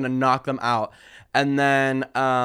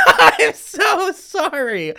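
laughing apology; 14 semitones higher, 6 dB louder, much slower than usual. Real, not acted. Mock sympathy, laughing at story.

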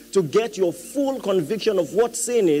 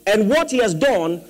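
raised, emphatic sermon voice; forceful preaching; 5 semitones higher, 4 dB louder than usual. Real, not acted. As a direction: drive each point home to the congregation.

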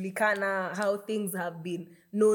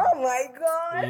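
mock swoon; 6 semitones higher, slower than usual. Real, not acted. Cohost reacts to romance.

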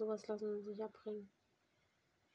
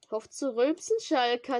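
loud joking telling-off; loud scolding; 3 semitones higher, 11 dB louder than usual. Real, not acted. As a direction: yell at him to stop. Scolding friend for burping. (lautes Schimpfen)

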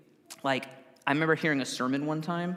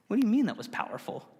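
disbelief; 7 semitones higher, faster than usual. Retold or imitated. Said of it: "And I'm like".

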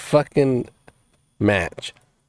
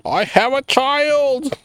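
movie quote imitation; much slower than usual. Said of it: "you remind me of the fucking dude".

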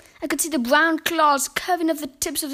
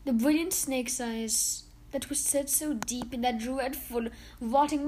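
plain reading voice

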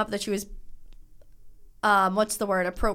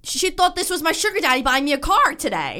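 louder, higher, indignant shout; exasperated outburst; 6 semitones higher, 4 dB louder than usual. Real, not acted. Telling her friend about the dealer lady's mix-up, exasperated shout. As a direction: blurt out the outrage.

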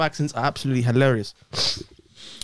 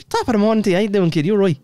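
put-on Irish greeting; mock Irish; Irish accent; 4 semitones higher, faster than usual. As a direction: hail a kid in a stock Irish accent. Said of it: "exactly like your Irish".

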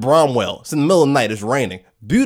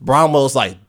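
ordinary delivery

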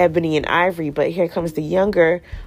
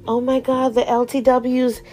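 higher-pitched worried realization; mock panic; 7 semitones higher than usual. Charlotte realizes a problem with her dinner party, mock alarm. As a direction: play her dawning social panic, pitched up.

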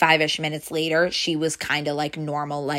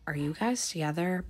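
much softer, slurred imitation of a mumble; mumbled under breath; 10 dB quieter than usual. Boss muttering to herself about the two, mumbled under her breath. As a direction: mumble it to yourself, barely voiced. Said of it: "or she'll mumble things".

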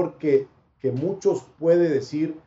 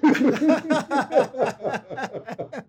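risa; 9 semitones higher than usual. Real, not acted. Se ríe de su propia broma.

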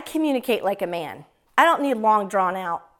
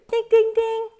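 bell imitation; 10 semitones higher, 3 dB louder than usual.

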